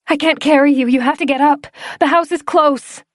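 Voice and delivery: high, loud pleading; girl begging her friend to get up; desperate urging. Acted. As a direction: push him to stand up. Urging him up.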